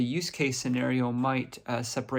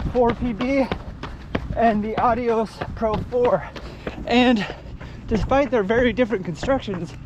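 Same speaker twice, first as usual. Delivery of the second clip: out of breath while running; 10 semitones higher, 8 dB louder than usual. Real, not acted. Talking while running the shoes.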